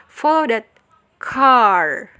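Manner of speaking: CAR punched loud and drawn out after a pause; stress on car. Shown as a demonstration. Teacher showing students the focus word, stress on "car". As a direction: put the weight on "car", long and loud. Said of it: "mendapatkan penekanan seperti".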